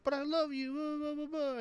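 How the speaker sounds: high mock-pleading girl voice; mocking high-pitched lovesick whine; lovelorn girl. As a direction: plead in a squeaky put-on voice, then babble it away. Retelling the girl's lovelorn plea.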